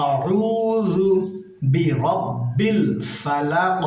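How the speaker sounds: slow, melodic chanted recitation; reciting Surah Falaq for students; Quran recitation. Shown as a demonstration. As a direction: stretch each word in chant. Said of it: "দাঁতের agar সঙ্গে লাগিয়ে বলবো".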